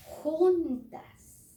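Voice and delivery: "juntas" high, loud and stretched; emphatic "juntas". Shown as a demonstration. Teacher stresses F and L go together, emphatic. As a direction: punch and lengthen the first syllable of "juntas".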